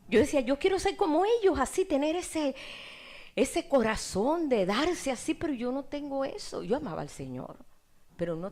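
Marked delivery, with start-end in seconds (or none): 0.4-5.3 high, pleading wish, slowing at the end; high yearning voice, drawn out on the last words; eager longing. Retold or imitated. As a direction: reach up high for what you long for. Retold longing to be like disciples.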